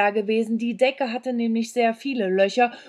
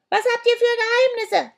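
put-on much higher, louder witch voice of an old woman; shrieking old hag; 7 dB louder than usual. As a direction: screech at the kids like a deaf old crone. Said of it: "schrie die Alte uns an". (kreischende alte Hexe)

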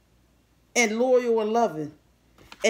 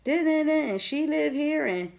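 higher, mocking voice of a jealous girl; lightly put-on catty gossip; catty jealous woman; 5 semitones higher than usual. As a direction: sneer it like a hater gossiping.

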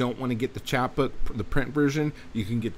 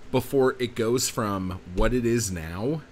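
ordinary talk

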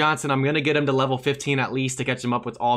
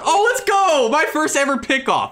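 way higher, loud burst of joy; ecstatic cheer; 12 semitones higher, 4 dB louder, slower than usual. Real, not acted. Player thrilled by his first ever pickoff, shouting with joy. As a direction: erupt with delight at a surprise win.

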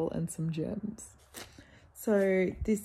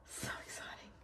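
excited whisper; 16 dB quieter, faster than usual. Real, not acted. Shelving new books.

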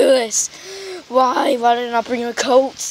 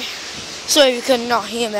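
normal talking voice

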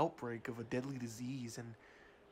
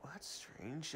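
whispered aside; 12 dB quieter, slower than usual. Acted. Reacting to coworker's story.

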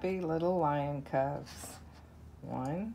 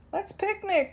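much higher, eager cub voice; roaring. Acted. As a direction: squeal it out like an excited cub. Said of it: "roars the lion cub".

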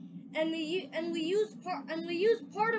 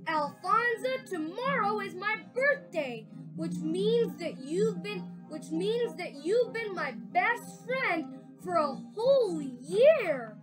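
slow, tender words to a friend; fond words; 5 semitones higher, 6 dB louder than usual. Acted. Lewis tells Alfonso about their friendship, tenderly. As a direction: speak from the heart.

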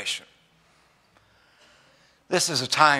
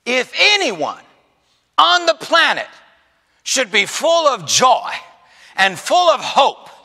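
loud, higher, slowed preacher's shout; preaching at full force; 11 semitones higher, 7 dB louder than usual. Acted. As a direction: build it into a rousing cry to the church. Preaching crescendo to congregation.

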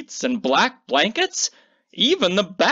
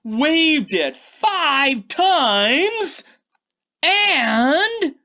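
slow build-up; 8 semitones higher, much slower than usual. A narrator's reading. Slow build to magic spell.

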